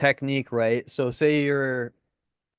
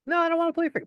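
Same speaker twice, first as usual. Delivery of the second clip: high-pitched, whiny no; high whiny refusal; much faster than usual. Retold or imitated. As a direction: whine it way up high. Reluctant friend voiced high.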